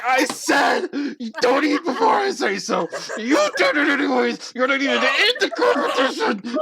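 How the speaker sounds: high, strained hysterical shouting; acted tantrum, screamed high; total meltdown. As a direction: rant as if losing all control. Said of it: "a total meltdown".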